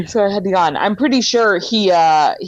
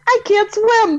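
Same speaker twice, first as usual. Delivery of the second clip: laughing; 13 semitones higher than usual. Retold or imitated. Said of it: "she goes".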